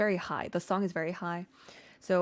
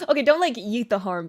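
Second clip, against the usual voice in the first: louder, quicker, laughing it off; take-back; 3 semitones higher, 7 dB louder, faster than usual. Real, not acted. Singer walking back her joke tip to viewers, amused and louder. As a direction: take back "yeet the harm" out loud with a grin.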